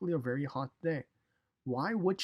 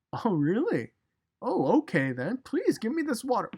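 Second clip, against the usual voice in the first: higher, brighter sudden eagerness, mock surprise; surprised eagerness; 8 semitones higher, 6 dB louder than usual. Woman surprised at Jesus' offer, eager and higher. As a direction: play the woman caught off guard, then keen. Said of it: "Some would say".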